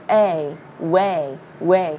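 "way" built up from the A vowel; "way" with a rounded onset, spoken separately; rounded W demo. Shown as a demonstration. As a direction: model "way" low with pursed lips. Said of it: "bạn chu cái miệng lên chút xíu".

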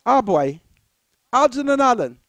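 ordinary delivery

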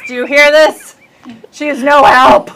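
normal talking voice

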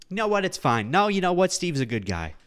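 ordinary delivery

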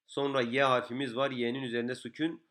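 ordinary delivery

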